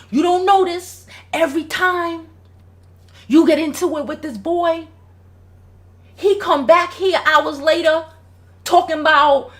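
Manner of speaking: voicing the dad. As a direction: plead the dad's case with heat. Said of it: "He was like".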